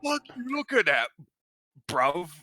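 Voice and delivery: shouted high Cockney thug voice; Cockney accent. Retold or imitated. Player voices the angry Lagoon in Cockney, yelling. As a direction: bark it like a London geezer spoiling for a fight. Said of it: "in a fucking Cockney accent".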